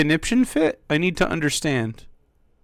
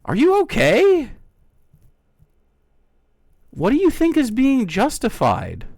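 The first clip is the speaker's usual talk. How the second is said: high, incredulous challenge; exasperated; 7 semitones higher, 4 dB louder than usual. Real, not acted. Streamer challenging a hostile chatter, exasperated. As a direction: demand an answer, voice jumping up.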